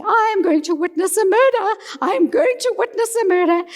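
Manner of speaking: the speaker's alarmed thought as the rhino charges; panicked thought. Retold or imitated.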